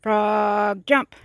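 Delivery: high, very loud, long-held frog then punched jump; full-on acted yell. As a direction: holler the command at the top of your voice. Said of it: "So he yelled at the frog".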